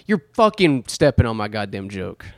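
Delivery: ordinary delivery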